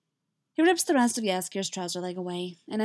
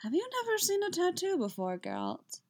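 dreamy, absent; 10 semitones higher, 4 dB quieter than usual. A narrator's reading. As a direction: wonder aloud, dazed and quick. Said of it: "still in that dreamy, absent voice".

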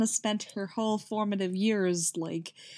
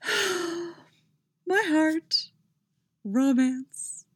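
swooning; 9 semitones higher, much slower than usual. Real, not acted. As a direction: sigh then much higher swoon. Reader swooning over romance.

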